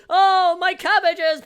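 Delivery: slow, high, loud anguished wail; cabbage merchant cry. Pretend. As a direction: drag out the cry of despair.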